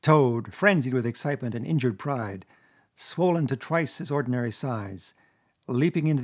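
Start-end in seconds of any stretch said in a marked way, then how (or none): none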